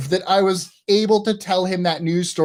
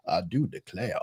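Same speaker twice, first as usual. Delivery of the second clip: distinguished Southern accent; 12 semitones lower, 12 dB quieter than usual. Shown as a demonstration. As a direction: put on a stately, much lower Southern accent. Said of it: "some can be very distinguished".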